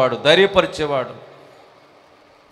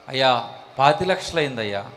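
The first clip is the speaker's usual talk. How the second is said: timid believer; 5 semitones lower, 5 dB quieter than usual. Acted. Believer answers pastor in skit.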